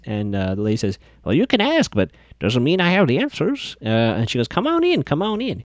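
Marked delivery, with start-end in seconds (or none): 1.2-3.8 lightly voiced cagey shopkeeper, pitched up; a hint of a cryptic old doll-shop lady; creepy old lady. Doll shop lady to the liars, lightly voiced cagey. 4.5-5.6 much higher creepy welcome, trailing off; creepy old lady. Retold or imitated. Doll shop lady inviting the liars in, high creepy sing-song.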